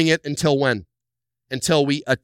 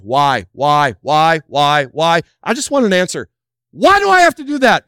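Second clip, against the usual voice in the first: rising, louder and louder why; mock petulant protest; mock complainer getting shrill; whiny demand; 2 semitones higher, 7 dB louder than usual. Mocking complaining congregant.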